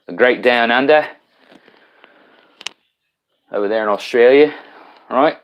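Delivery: badly butchered mock Australian accent; a clumsy playful stab at an Australian accent; jokey half-done Australian accent for a viewer down under; Australian accent attempt. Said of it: "Sorry for my butchering of your country's, uh, accent".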